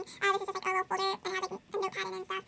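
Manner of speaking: ordinary talk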